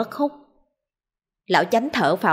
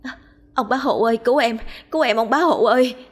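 higher-pitched plaintive female voice; acted woman's plea, pitched up; woman calling for help; 5 semitones higher than usual. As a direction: call out sweetly for rescue. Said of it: "tiếng kêu của một phụ nữ".

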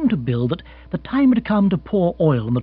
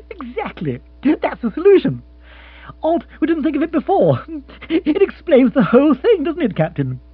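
excited; 8 dB louder than usual. Acted. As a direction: cry out in triumph. Lord eager.

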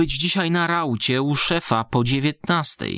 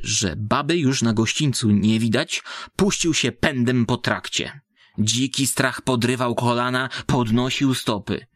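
usual speaking voice

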